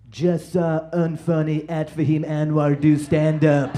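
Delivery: slow, loud, drawn-out tweet read in a put-on voice; mock Trump tweet. Trump tweeting about the comic's set, slow mocking read. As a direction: sneer it out word by word like a tweet. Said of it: "hop on Twitter and see".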